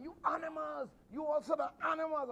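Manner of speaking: imitated angry woman's jeer; intimidating heckler. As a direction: hurl it like a ringside heckler. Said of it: "One lady trying to intimidate my kids".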